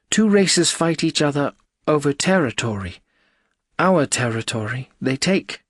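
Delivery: high, strange alien woman; female alien voice. Acted. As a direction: answer briskly in a high alien voice. Female alien explains the war.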